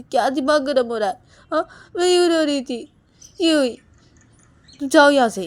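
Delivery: high, loud go-away shouting; angry dismissal. Acted. Wife shouting at husband to leave, angry. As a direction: drive him out of the room.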